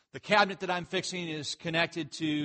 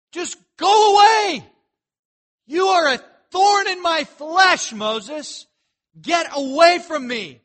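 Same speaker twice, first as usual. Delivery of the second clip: loud, high, slow furious shout; Pharaoh's rage; 10 semitones higher, 12 dB louder than usual. Acted. Pharaoh screaming at Moses to go away, furious shout. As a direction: roar it like a king who has had enough. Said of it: "he finally blows his top".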